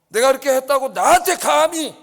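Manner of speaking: how dare you, at full volume; furious shout. Acted. General shouting at the king, furious. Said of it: "아브넬이 화가 났어요".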